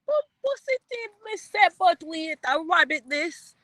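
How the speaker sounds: high, playful mock Jamaican patter; a streamer goofing in a mock Jamaican accent for chat; fake Jamaican. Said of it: "It's your Jamaican here".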